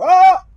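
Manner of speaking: very high, loud scream; piercingly loud high yell; startled scream. Real, not acted. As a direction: scream in sudden shock. Hit while crossing the street.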